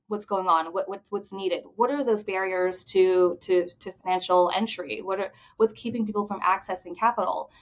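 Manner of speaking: ordinary delivery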